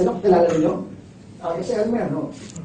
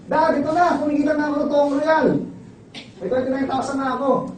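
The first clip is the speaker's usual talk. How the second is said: son pleading with his military father, higher and quick; lightly voiced eager son, higher; 8 semitones higher than usual. Son telling his colonel father about earnings, eager and high.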